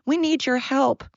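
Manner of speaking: high, whiny begging voice; mock them begging for help; mock pleading whine. Mocking weak countries begging America for help, high whine. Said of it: "And they're like".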